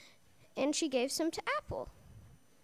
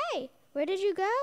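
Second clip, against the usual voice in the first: quick, louder call searching the garden; 4 semitones higher, 5 dB louder, faster than usual. Acted. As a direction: call out as if looking for someone. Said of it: "called God".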